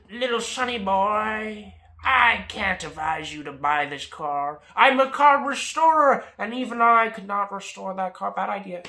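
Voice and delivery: big, loud, drawn-out mechanic voice; hammed-up mechanic. Mechanic warning a youngster off a car, hammed-up. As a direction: play a booming know-it-all advising a youngster. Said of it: "mechanic that I just met here".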